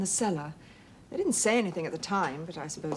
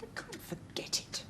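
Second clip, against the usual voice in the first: anguished whisper; 12 semitones higher, 11 dB quieter than usual. Acted. Wife's anguished reply about the past.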